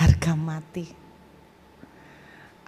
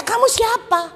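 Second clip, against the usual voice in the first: high, loud demand; insistent questioning; 13 semitones higher, 5 dB louder than usual. Retold or imitated. Young man asking the unseen voice, high insistent demand. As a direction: demand to know who is there.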